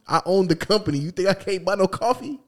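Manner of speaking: rising, high-pitched mock indignation; exasperated owner. Owner refuses employee's coffee, high exasperated pretend rant.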